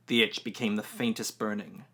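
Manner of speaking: ordinary delivery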